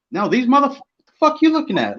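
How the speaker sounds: high-pitched indignant challenge; retold confrontation. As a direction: act out squaring up, voice jumping high. Retold reaction to being mad-dogged.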